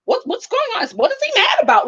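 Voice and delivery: high, anxious questioning; the producer asks the couple what is wrong, high and worried. Retold or imitated. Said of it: "the producer is".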